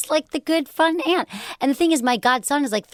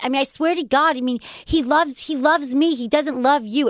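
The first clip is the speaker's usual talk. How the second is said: raised-pitch mock Jersey Shore girl; Jersey Shore accent; 4 semitones higher than usual. Jersey girl confronts friend about boyfriend, mock Jersey Shore accent. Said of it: "Do a Jersey Shore".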